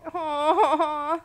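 loud laugh into a pitying aww. Real, not acted. Player laughs at the crying fox, a loud laughing aww. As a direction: crack up, then coo.

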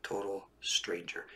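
hushed, breathy warning to the listener; whisper. Real, not acted. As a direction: whisper it like a secret reproach.

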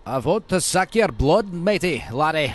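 loud, hammed-up Scottish vampire; mock Scottish Transylvanian, projected loud; Scottish-accented Dracula. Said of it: "with his Scottish accent".

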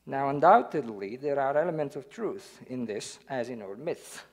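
ordinary delivery

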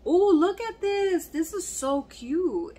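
high, loud, slow delighted gush; excited squeal. Real, not acted. Spotting the Barbie gift while unboxing, thrilled and high. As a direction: squeal over the find, drawing it out.

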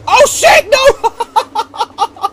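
very high, loud yelp into laughter; laughing shriek. Real, not acted. Player caught by a mishap, laughing shriek. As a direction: shriek it and crack up.

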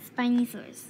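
normal talking voice

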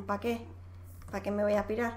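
a hint of an idle reaper; lazy reaper voice. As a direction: shrug it off like a bored grim reaper. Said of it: "Porque dice".

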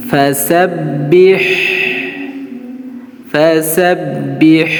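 ফাসাব্বিহ said very slowly with a doubled b; slow model recitation. Shown as a demonstration. Teacher models the word for students to repeat. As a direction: draw out ফাসাব্বিহ syllable by syllable. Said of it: "আমার সঙ্গে সঙ্গে আপনারা বলেন".

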